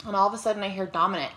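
normal talking voice

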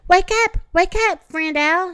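high, loud excited yell; excited shout. Acted. Thumper shouts up at sleeping Owl, high excited yell. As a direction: shout him awake with glee. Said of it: "shouted Thumper".

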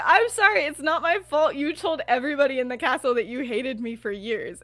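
loud, high-starting self-defence; mock defensiveness. James defending himself to Lily, mock indignant. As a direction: push back hard in a playful squabble.

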